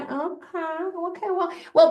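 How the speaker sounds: skeptical. Retold or imitated. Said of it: "you would look at me going".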